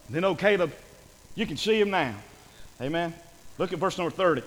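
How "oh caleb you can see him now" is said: normal talking voice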